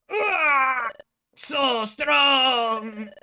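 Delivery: loud strained cry of effort; big pained battle yell; battle shout. Acted. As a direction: yell it like a blow lands hard. Character cries out in battle.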